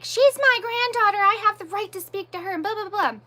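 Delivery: high-pitched mocking whine of protest; annoying grandmother, mocked. Mother mocking the grandmother's protest, high annoying voice. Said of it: "Then she got all annoying".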